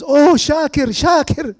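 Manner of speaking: high, loud mock calling out; wife's frantic call, acted out; retold frantic calling. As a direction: imitate a wife crying out a name, high and loud. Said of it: "and she goes".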